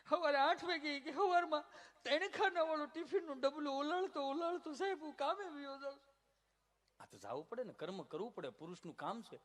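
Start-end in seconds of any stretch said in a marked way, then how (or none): 0.1-0.9 high, loud mock sob to the doctor; hammed-up weeping complaint; mock crying. 1.1-6.1 high, pitiful whining; a hammed-up tearful plea; mock whine. Patient's whining complaint to doctor.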